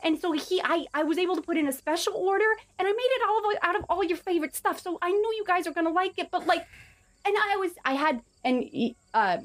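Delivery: high, eager little goblin voice; excited goblin. Acted. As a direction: babble like a proud, nervous goblin. Plays Baha the goblin.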